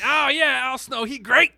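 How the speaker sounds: high, loud dopey broken English; mock French giants praising Snow; dumb giant voice. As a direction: gush like a dim giant. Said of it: "they're like".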